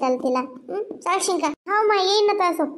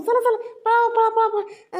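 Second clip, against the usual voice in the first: high, urgent calls to run; raised-pitch repeated urging; urgent alarm; 6 semitones higher, slower than usual. Acted. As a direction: hurry them away from the rain. Urging others to flee the rain.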